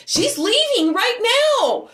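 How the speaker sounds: high, drawn-out alarmed cry; frantic urgency. Retold or imitated. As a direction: stretch it out high, urging help now. Urgent report to police.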